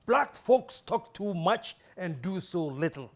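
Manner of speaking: slow, deliberate pronouncement; measured, emphatic quotation; quoting a mentor. Retold or imitated. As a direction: deliver it like a weighty maxim. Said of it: "He says".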